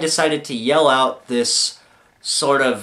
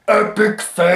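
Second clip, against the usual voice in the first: yelled out; 9 semitones higher, 5 dB louder than usual. Acted. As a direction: yell it like a heckler, stretching the last word. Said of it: "someone to yell out".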